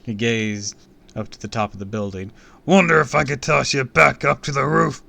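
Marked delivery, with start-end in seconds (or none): 2.6-5.0 high, blustering villain shout; loud threat. A narrator's reading. Villain eyeing the rooftop above kid, loudly menacing. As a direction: threaten at full voice.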